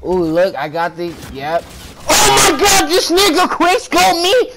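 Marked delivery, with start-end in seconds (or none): 2.1-4.5 high-pitched angry gamer yell; outraged, high shouting; getting mad. Real, not acted. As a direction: yell furiously at the player who got you. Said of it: "I'm getting mad".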